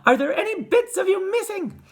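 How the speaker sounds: very high, loud mother's wail; anxious high-pitched calling; frantic cry. A narrator's reading. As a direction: cry out in worry to your child. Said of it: "cried Missus Teavee".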